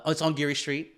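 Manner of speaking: ordinary talk, nothing marked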